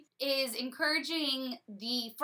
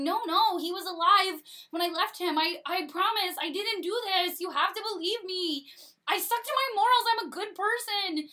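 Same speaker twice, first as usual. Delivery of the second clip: higher, louder frantic pleading; desperate Hubert; 8 semitones higher, 5 dB louder than usual. Retold or imitated. Hubert pleading innocence to the lords, high and frantic. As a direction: beg them to believe you. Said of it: "And Hubert's going".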